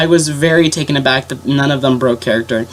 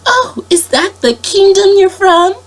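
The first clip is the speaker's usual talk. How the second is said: high, slow princess question; much higher, slow storybook sweetness; 4 dB louder, slower than usual. Retold or imitated. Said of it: "she was like".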